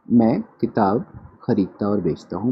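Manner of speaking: ordinary talk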